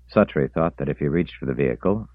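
usual speaking voice

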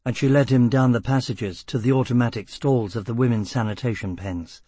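plain reading voice

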